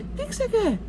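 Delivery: lightly voiced, high-pitched questioning vendor; high mock query; imitated shopkeeper. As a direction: mimic a vendor quizzing a small child. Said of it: "Aí ele".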